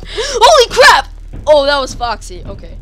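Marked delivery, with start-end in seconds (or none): 0.1-1.6 very loud, high, drawn-out yell; shocked shout. Real, not acted. Player startled by Foxy, high shocked shout. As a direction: scream it in shock. Jump scare in game.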